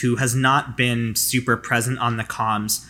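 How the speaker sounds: usual speaking voice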